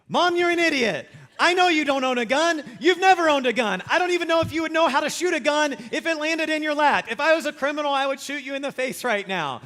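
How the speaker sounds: loud, high, ranting in disbelief; shouting at mom in his head, high and loud; exasperated inner shout. Retold or imitated. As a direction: yell it like you can't believe her. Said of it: "I am thinking".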